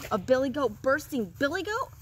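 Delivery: lightly slobbery troll question; a touch of greedy drooling; slobbering troll. A narrator's reading. Greedy troll hears of bigger goat, mild slobber. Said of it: "slobbered the greedy troll".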